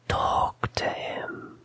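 slow, very soft whisper. A narrator's reading. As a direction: whisper it as an imagined voice in his ear. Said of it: "He pretends the whisper says".

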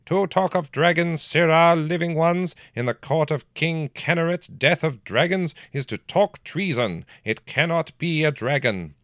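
put-on a touch of a sharp old counselor. A narrator's reading. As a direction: lecture him like a cold counselor. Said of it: "In a sharp voice he continued".

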